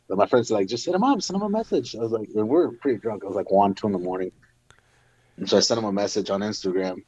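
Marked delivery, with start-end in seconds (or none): 0.7-1.9 lightly voiced high-pitched friend; egging friend. Drunk friend urging him to send a message, lightly mimicked in high pitch. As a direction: urge it along in a higher voice.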